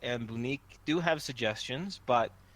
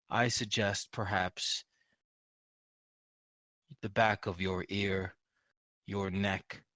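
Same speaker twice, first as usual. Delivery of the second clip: bear voice; 6 semitones lower, 6 dB quieter than usual. Acted. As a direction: rumble it out softly like a big bear. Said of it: "I have to use the bear voice".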